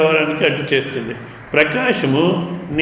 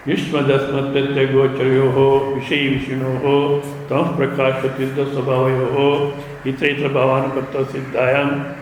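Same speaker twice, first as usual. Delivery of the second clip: lower, steady chant-like recital of the Sanskrit verse; Sanskrit scripture recitation; 3 semitones lower than usual. Acted. Teacher reciting Shankara's commentary to students, in a lower chant-like recital. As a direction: recite the bhashya like a sacred text.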